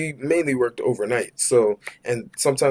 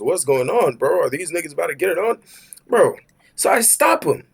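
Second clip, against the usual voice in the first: ordinary talk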